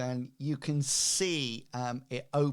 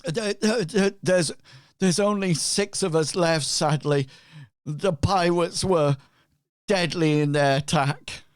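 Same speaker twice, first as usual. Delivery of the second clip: louder, higher character voice; raised voice, halting report of losses; non-player survivor; 5 semitones higher, 7 dB louder than usual. Acted. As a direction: answer loudly as the battle's survivor. Game master answers as Benito.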